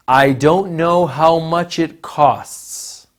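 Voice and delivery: slow, even pitch on know, falling at the end; flat statement tune. Shown as a demonstration. Teacher models an indirect question read flat. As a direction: say it level like a statement. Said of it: "commonly associated with a flat intonation pattern".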